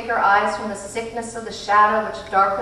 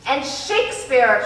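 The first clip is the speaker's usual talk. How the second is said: louder, slower eager call; 5 semitones higher, 5 dB louder than usual. A narrator's reading. Charles Wallace calling out a name, loud and eager. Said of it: "called out".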